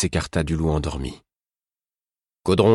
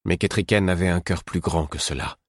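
ordinary delivery, nothing marked

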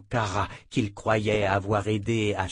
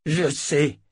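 a higher, louder angry retort; roar; 5 semitones higher, 4 dB louder than usual. A narrator's reading. Richard snapping at Kara in grief, roaring. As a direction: bark it back in rage. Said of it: "rugit Richard".

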